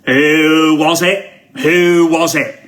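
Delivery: stern usher. Retold or imitated. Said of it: "The guy holding the torch is like".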